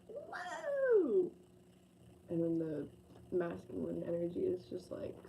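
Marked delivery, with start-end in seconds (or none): 0.1-1.3 high, drawn-out woo; playful whoop. Acted. Reader voices free feminine energy, high playful whoop. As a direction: let out a long high-pitched whoop. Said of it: "The feminine energy is very, like".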